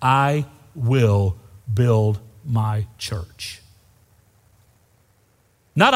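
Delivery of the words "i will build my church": "I will build my church" very slow and lower, each word weighed; slow and solemn. Real, not acted. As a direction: drop low and lay down every word of "I will build my church". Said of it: "listen to me, beloved".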